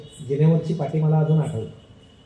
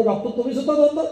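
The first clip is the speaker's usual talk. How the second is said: mock cry out; 7 semitones higher, faster than usual. Man seeing double exclaims to the eye doctor, mock high outcry. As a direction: act out a startled patient's outcry, raised in pitch. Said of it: "हा ओरडतो".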